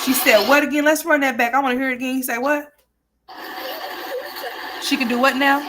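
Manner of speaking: high, loud, incredulous questioning; incredulous disbelief. Real, not acted. Host reacts incredulously to replayed threat.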